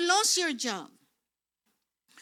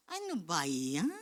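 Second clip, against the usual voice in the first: much lower, quiet groaning aside; mock dismay; 11 semitones lower, 10 dB quieter than usual. Preacher reacting to the repeated offence, low mock dismay. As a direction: drop down low in weary disbelief.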